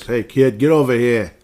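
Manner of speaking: loud hammed-up East Coast tough; East Coast accent. Podcaster parodying a Boston-New York matchup, loud East Coast accent. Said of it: "East Coast accent".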